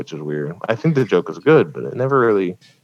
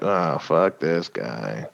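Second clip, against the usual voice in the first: muttering crowd; 6 semitones lower, 9 dB quieter, much slower than usual. Retold or imitated. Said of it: "they're all like".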